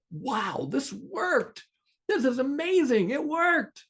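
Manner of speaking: high-pitched, excited exclamation; amazed delight. Retold or imitated. Sitter as the tantrum trick works, amazed and excited. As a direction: relive the astonished triumph, pitch leaping up. Retold amazement at her success.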